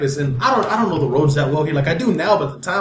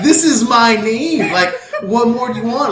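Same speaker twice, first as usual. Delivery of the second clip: slow, raised, drawn-out exasperation; exasperated protest; 3 semitones higher, slower than usual. Retold or imitated. Showing the cop his blood donor card, exasperated. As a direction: spell it out in mock frustration.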